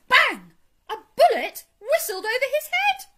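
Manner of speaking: explosive, high-pitched storytelling; thrilled shouted narration; dramatic climax. A narrator's reading. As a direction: burst out with the gunshot, very loud.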